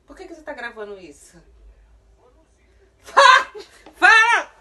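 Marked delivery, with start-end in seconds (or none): 3.0-4.5 high, loud screaming, slowing down. Real, not acted. Mom screams at son who stays silent. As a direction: scream till it cracks.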